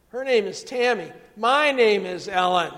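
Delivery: loud, emphatic punchline; exasperated correction. Retold or imitated. Mother setting the admiring stranger straight, loudly exasperated. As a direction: raise the voice and hammer the names.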